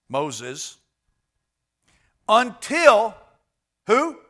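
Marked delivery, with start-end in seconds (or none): 2.3-3.2 "until" thrown out loud and high; emphatic until. Acted. Preacher stressing "until" to the congregation, loud. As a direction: punch "until" as the turning point. Preacher hammers key word.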